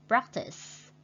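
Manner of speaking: PRACtice with the first syllable punched; stress on first syllable. Shown as a demonstration. Teacher modelling practice with stress on PRAC. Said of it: "stretch mark on the first syllable".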